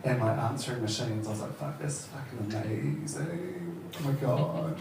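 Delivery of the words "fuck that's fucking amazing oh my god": hushed, drawn-out amazement; slow and soft, marvelling; awed. Retold or imitated. Comedian recalls seeing eighties American TV homes, hushed awe. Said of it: "I used to be really amazed".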